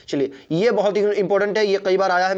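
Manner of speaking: ordinary delivery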